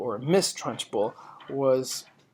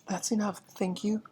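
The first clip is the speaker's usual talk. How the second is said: high, softer timid reply; boy murmuring; 8 semitones higher, 3 dB quieter than usual. Acted. Boy politely refusing more cake, high and softer. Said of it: "the boy murmured".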